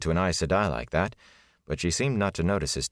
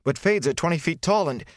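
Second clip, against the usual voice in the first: much higher, louder rushed protest cut off; alarmed protest; 11 semitones higher, 3 dB louder than usual. A narrator's reading. Rand protesting to the warder about Fades, much higher. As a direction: blurt it out then break off. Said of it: "The words faded away".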